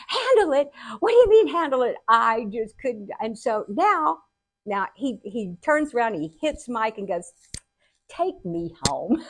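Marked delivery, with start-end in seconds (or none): 0.1-2.0 high, loud, outraged echo; hammed-up exasperated squawk; indignant disbelief. Outraged reply to her dad. 8.1-9.2 slow, unbothered request; lightly voiced father closing the subject; matter-of-fact dad. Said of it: "so matter of fact".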